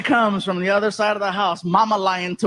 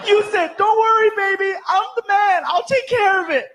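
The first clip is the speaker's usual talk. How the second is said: mocking girlfriend; 11 semitones higher, 4 dB louder than usual. Girlfriend mocking him, high.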